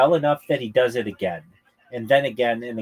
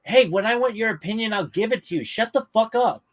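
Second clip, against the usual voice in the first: mock heckler put-down; 9 semitones higher than usual. Acting comic's retort to heckler.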